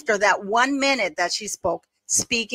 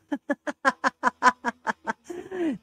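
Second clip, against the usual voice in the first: long high giggling fit; amused laughter; 5 semitones higher, 4 dB quieter than usual. Real, not acted. Host reacting to the fraud joke, laughing hard. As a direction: burst into a long run of laughter. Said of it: "This is too funny".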